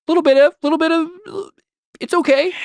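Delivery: squeaky high mockery of moderation; mocking high voice. Dad mocking a small portion in a squeaky high voice. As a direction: pipe up high and silly on little bit of. Dad mimics little bit.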